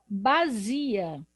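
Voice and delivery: bazia with a z sound in place of the s; wrong reading. Shown as a demonstration. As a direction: say bazia with a buzzing z. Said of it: "você vai escrever, ó".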